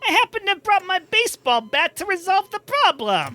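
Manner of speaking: high menacing voice. Acted. Honda guy threatens.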